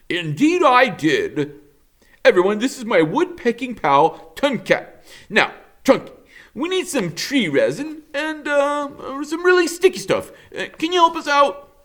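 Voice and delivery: high, bouncy beaver voice, slowing at the end; a confident helper taking charge; cheerful beaver. Acted. Mister Beaver reassuring the robot, high and bouncy. Beaver introducing his pal.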